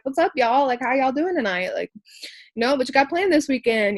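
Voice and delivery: high, loud bubbly hello; upbeat greeting. Retold or imitated. Pulling up a chair by the girls, loud bubbly greeting. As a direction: greet the group like old friends.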